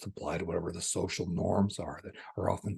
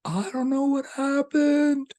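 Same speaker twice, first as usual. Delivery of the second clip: much higher, louder, slow bewildered woman; laughing woman voiced; 10 dB louder, slower than usual. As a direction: play her helpless and amazed. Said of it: "And she said".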